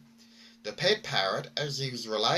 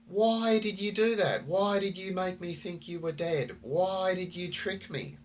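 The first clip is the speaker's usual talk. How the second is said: shocked outburst; 7 semitones higher than usual. Retold or imitated. Man confronting the parrot that faked its death, shocked and indignant. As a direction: demand answers from the tricky parrot.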